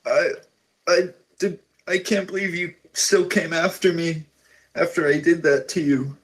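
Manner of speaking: high, halting, weepy disbelief; tearful sister. Acted. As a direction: stumble over the words, near tears. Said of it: "she starts weeping".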